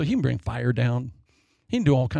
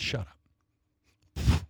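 "Shut up" breathed in a low whisper; whispered command; 3 semitones lower, 3 dB quieter than usual. Retold or imitated. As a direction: hush the storm with a whispered "Shut up". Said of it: "He said".

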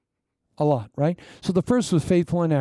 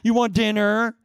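put-on higher, sing-song voice for the baby; mock baby talk; 8 semitones higher than usual. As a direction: coo at the baby while bleeding, half laughing. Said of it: "And I go".

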